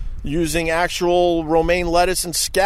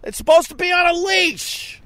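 angry protest. Real, not acted. Snapping back at the dog owner, angry and high. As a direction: demand it, outraged and shrill.

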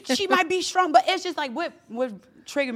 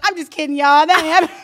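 mock teasing; 6 semitones higher, 12 dB louder than usual. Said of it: "And I was like".

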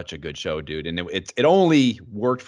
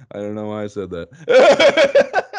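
slow, louder, laughing at himself; laughing through words; 9 dB louder, slower than usual. Real, not acted. Host laughing at his own silly urn claim, laughing. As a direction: giggle through the admission.

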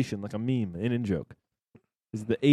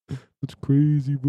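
slow drawn-out bro; 4 semitones higher, 4 dB louder, much slower than usual. Pretend. Stretched bro reaction.

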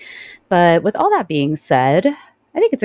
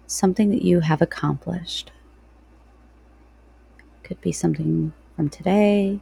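guided meditation. Real, not acted.

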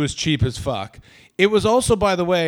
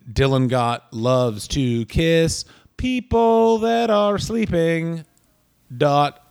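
drawn-out announcement; much slower than usual. Pretend. Joke Facebook group name spelled out.

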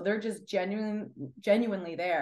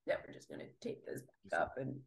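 breathy hushed whisper, much softer and lower; 12 dB quieter, faster than usual. Retold or imitated. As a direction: whisper it like a secret shrug-off. Joking about hiding thumb injury.

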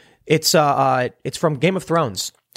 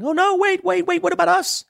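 high, hammed-up panicky pleading; squeaky mock alarm. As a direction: play the frightened passengers begging for help. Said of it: "now they're panicking".

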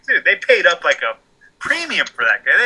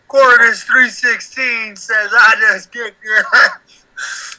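high, slow ring announcer; announcer hype; 6 semitones higher, much slower than usual. Acted. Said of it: "This is a wrestling promo".

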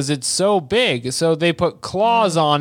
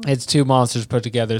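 ordinary talk

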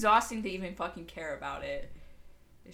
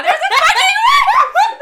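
shrieking at a worm; startled scream; 23 dB louder, slower than usual. Real, not acted. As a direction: scream in shock and disgust. Sudden worm scare, screaming.

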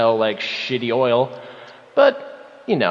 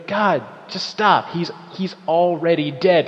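higher, slower imitation of horrified onlookers; pleading crowd; 5 semitones higher than usual. Wrestler mimicking the crowd watching him beat Griffin, pleading. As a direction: plead on the crowd's behalf, lightly acted. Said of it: "they're gonna be".

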